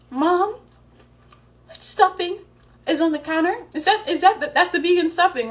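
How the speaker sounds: panicked question. Retold or imitated. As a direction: ask hoping the answer is no. Panicked phone call to mom.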